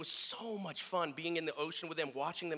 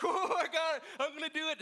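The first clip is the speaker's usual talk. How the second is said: put-on high, eager little boy voice; excited kid; 10 semitones higher, 3 dB louder than usual. Son telling his dad he can land the fish, high and eager. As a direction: chirp it like a thrilled kid.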